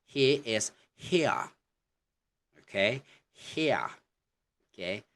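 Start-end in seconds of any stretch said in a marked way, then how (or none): 0.0-1.5 "here" slow and higher, ending in an open uh with no R; British HERE. Shown as a demonstration. Teacher showing students British "here", non-rhotic and drawn out. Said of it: "So, for example". 3.3-3.9 "here" as "hee-uh", R left silent; British HERE. Shown as a demonstration. Teacher repeats the example for students, non-rhotic "here". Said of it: "maybe add an uh".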